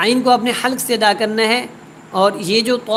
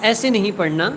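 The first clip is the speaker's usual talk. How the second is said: usual speaking voice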